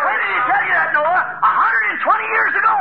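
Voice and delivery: high, loud jeering question; mocking scoffer. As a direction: taunt Noah with the years. Sinner mocking Noah.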